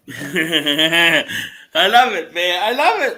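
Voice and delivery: loud, high delighted exclaiming; real, uncontained glee. Real, not acted. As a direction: crow it loudly through laughter. Delighted by the safe character bit.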